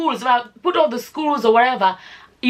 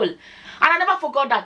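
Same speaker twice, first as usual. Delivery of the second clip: usual speaking voice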